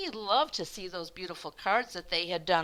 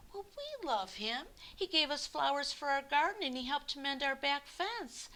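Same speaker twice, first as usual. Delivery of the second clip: higher, earnest neighbour voice; lightly voiced neighbour; 7 semitones higher than usual. Neighbour telling the postman how they love Mister Hatch, higher voice. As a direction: speak up fondly for him.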